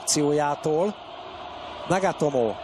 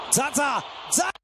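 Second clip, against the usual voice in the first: excited name shout; 8 semitones higher than usual. Real, not acted. Chance called live.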